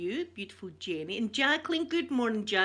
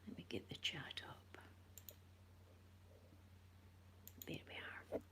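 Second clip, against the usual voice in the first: whispering; 6 semitones lower, 21 dB quieter than usual. Real, not acted. Quiet aside while setting up.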